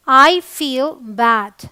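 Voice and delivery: very slow, each word stretched out; slow teaching demo. Shown as a demonstration. Teacher modelling I feel bad for learners, very slow. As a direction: model it slowly so students can copy. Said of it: "मुझे बुरा लगता है".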